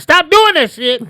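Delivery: high, slow scolding mom; scolding mother voice. Retold or imitated. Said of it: "You want like".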